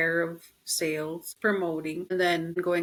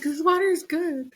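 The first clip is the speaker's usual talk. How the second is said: much higher, louder gushing; tearful delight; 11 semitones higher, 5 dB louder than usual. Retold or imitated. Answering her friend while crying over water, gushing high. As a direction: gush about the water, teary. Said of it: "I was like".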